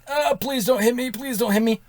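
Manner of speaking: high, soft, scared begging; frightened pleading. Retold or imitated. Lying bleeding under an oncoming car, high hushed begging.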